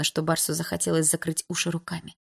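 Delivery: usual speaking voice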